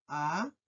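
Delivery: "Allá" softly as a, long pause, llá; syllable by syllable. Shown as a demonstration. Teacher repeating the syllable count, "Allá" softly split in two. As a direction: separate "Allá" into two low, quiet beats. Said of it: "Tiene dos sílabas".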